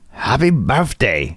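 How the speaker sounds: slow, bad German accent; a badly faked German accent. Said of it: "I was trying to do a German accent".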